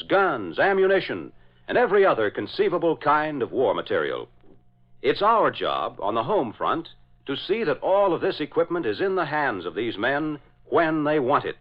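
ordinary talk, nothing marked

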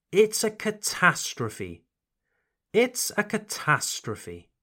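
It's a catastrophe with catastrophe as kuh-TAS-truh-fee; model for repeating. Shown as a demonstration. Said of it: "try repeating this sentence after me".

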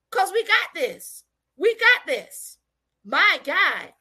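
impassioned preaching, high rising phrases. Real, not acted. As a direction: drive the point home to the congregation. Preaches on the blood.

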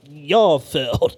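slow, low and let down; a hint of a weary preacher; disappointed MLK. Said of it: "Doctor King gotta be looking down at us like".

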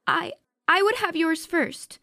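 very high, loud, frightened stammer; stammering Maga. A narrator's reading. Maga answers the spirits, stammering in fear. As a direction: force out the words through fear. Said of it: "stammered Maga".

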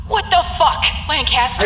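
furious shout. Acted. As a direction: yell at him in disbelief. Furious at the intruder.

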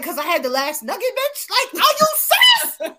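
sassy, climbing up high into a laugh; mock outraged. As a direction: act offended over a nugget. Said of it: "you be like".

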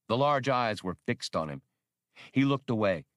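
usual speaking voice

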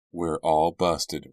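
plain reading voice

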